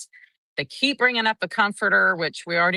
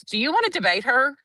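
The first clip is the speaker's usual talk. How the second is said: imitated sarcastic rebuke, pitched higher; mock the witness for arguing; sarcastic judge; 8 semitones higher, faster than usual. Judge rebuking the witness for arguing, sarcastic imitation. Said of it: "judge looks at her and goes".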